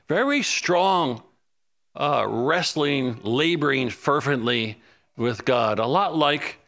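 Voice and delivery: ordinary delivery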